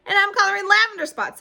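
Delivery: high, loud proud announcement; eager girl. A narrator's reading. Charlotte announcing her spots, high and loud. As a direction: chime in proudly. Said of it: "said Charlotte".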